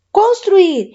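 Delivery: ordinary delivery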